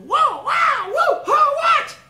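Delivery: very high, loud whooping yells; wild beach shouts of amazement; excited screaming. Retold or imitated. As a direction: whoop like you've just seen a miracle. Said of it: "those guys start screaming".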